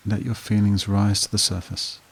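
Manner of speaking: even, level meditation voice with long pauses; guided meditation. Acted. Therapist guiding listeners back through a painful memory, guided meditation voice. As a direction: guide the listener steadily, leaving long silences between prompts.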